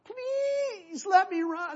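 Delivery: high, very slow whiny plea; a young man whining to his commander to let him run; whining Ahimaaz. Acted. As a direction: whine and drag out the begging. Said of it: "He's whining".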